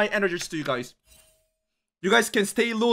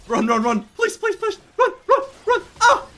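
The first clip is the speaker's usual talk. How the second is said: frantic, pleading, pitch shooting up; panicked begging while fleeing; being chased; 10 semitones higher, 3 dB louder than usual. Real, not acted. Fleeing enemies in game.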